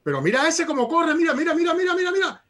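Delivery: high, loud, fast excited repeating; imitating an excited father urging look, look; dad's excited Spanish. Son imitating his Cuban dad watching video, high excited. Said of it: "all he kept saying was".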